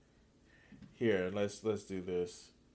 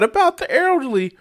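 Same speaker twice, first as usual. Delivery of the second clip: higher mock worried plea; mocking pearl-clutcher; 5 dB louder than usual. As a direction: parody his fake concern with a lifted voice. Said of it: "I never expected him to be".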